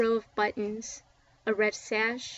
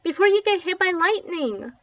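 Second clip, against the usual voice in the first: high, loud shout over the rain; 7 semitones higher, 7 dB louder than usual. A narrator's reading. Little Mac calling the kids out of the storm, shouting. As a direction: bellow them in off the street. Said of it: "shouted Little Mac".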